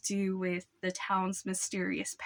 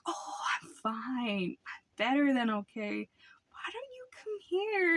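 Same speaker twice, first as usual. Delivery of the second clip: creepy whisper; 4 semitones higher than usual. Retold or imitated. Neighbor beckons Archie closer in an eerie whisper. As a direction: lure him in with a spooky whisper.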